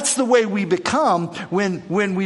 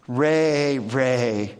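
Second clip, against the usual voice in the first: calming; 5 semitones lower, much slower than usual. Shown as a demonstration. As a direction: settle him down name by name. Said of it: "Let's calm down".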